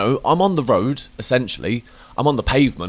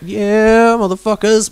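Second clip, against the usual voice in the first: high, whooping swagger to the music; rowdy driver; 11 semitones higher, 4 dB louder, slower than usual. Acted. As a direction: holler it out of a car window. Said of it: "I'm just gonna be like".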